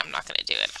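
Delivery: whispered refusal under the breath; whispered aside. Real, not acted. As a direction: drop to a whisper, teasing. Refusing the Valley Girl accent.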